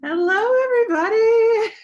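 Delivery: high, loud, overjoyed greeting; happy shriek of hello; excited squeal. Real, not acted. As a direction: burst out with teary delight. Overwhelmed host greeting her live audience.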